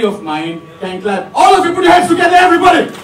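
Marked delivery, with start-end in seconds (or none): 1.3-2.9 loud, fast crowd call; shouting. Real, not acted. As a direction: rouse the whole room. MC rousing the crowd to clap.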